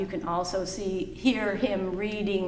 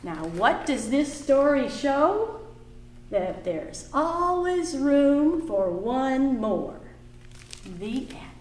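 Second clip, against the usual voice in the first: put-on reading to children; 4 semitones higher, 4 dB louder than usual. Teacher reading a picture book aloud to the class.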